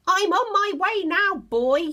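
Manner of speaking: high, loud call from above; Grandma calling down to George from above. Acted. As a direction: call down to the boy below. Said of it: "she called down".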